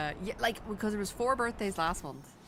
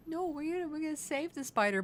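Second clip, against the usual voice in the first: mock cheerful; 10 semitones higher than usual. Couple's answer.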